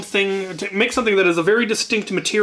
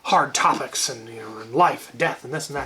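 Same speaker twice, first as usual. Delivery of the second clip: lower, mock-gushing art teacher; brother's teacher praising his Spam fetus, lower mock-gushing; imitating the teacher; 4 semitones lower, 4 dB quieter than usual. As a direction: play the teacher heaping praise, a bit lower.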